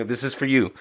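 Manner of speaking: ordinary delivery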